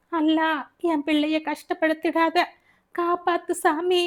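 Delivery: high pleading cry to God; desperate prayer. Acted. Mother praying over her son in pain, high desperate plea. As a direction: beg Allah to spare him.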